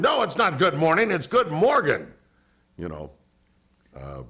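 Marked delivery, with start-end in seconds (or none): 0.0-2.1 higher, louder mock correction, leaning on Morgan; hammed-up insistence on his own name; correcting Morgan.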